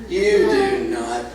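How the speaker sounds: slow, low, disbelieving denial; disbelieving retort. Acted. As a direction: spell out the disbelief word by word. Disbelieving friend in acted argument.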